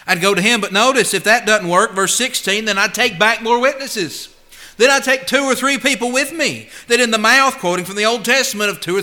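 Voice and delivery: climbing sermon fervor; rising preaching. Acted. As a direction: drive the point home from the pulpit. Preaching builds to full force.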